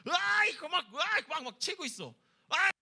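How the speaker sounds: high, loud roaring outburst; out-of-control rage. Acted. Pastor acting out David losing control, raging shout. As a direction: yell as if lashing out in a fit. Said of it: "다윗 막 감정 조절이 안 되고 있어요".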